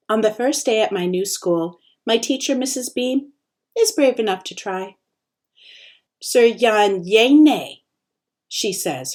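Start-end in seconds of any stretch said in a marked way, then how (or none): 6.2-7.8 very slow, syllable by syllable; sounding out a name. A narrator's reading. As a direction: stumble through the foreign name slowly. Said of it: "attempts to sound it out".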